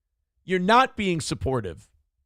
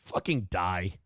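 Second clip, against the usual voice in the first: deadpan mock curse; 10 semitones lower, 6 dB quieter than usual. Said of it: "I didn't mean to say that".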